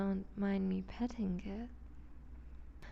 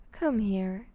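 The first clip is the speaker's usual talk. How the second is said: ordinary talk, nothing marked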